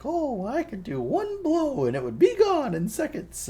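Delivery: very high, slow, sing-song clown voice; Pennywise. Retold or imitated. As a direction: lure a child in with a high, drawn-out promise. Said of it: "then he's all like".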